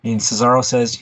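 ordinary talk

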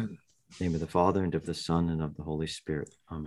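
soft, quick mumbled blessing; murmured prayer. Real, not acted. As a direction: rattle it off under your breath. Quick private sign of the cross.

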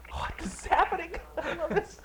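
squeaky high delighted surprise; high giddy disbelief. Real, not acted.

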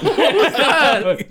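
high, drawn-out, laughing question; laughing disbelief. Real, not acted. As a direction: ask it incredulously through laughter. Reacts to a co-host's odd noise.

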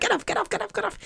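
put-on very high, soft squeal of a tickled child; high breathless "get off" of a tickled child; squealing kid. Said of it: "they're like".

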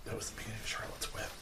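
soft unvoiced whisper. Real, not acted. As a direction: breathe it as a secret. Aside during in-character chat.

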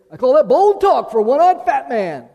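loud, high movie-villain line with a laugh; Ned Pepper quote. Retold or imitated. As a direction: sneer it out like a Western outlaw, laughing. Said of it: "like what Ned Pepper s-, said in True Grit".

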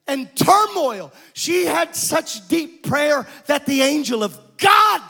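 high, slow, drawn-out preacher's cry; impassioned preaching. Acted. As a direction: stretch key words for the congregation. Preaches at full force.

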